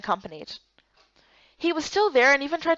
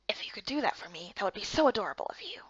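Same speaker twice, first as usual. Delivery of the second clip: whisper; 3 semitones lower, 8 dB quieter than usual. Acted. Coaxing whisper to a friend.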